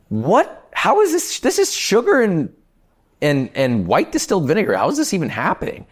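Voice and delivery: high-pitched retold disbelief; baffled outburst, pitch leaping up; 震 is not used here. Acting out his shock at fire.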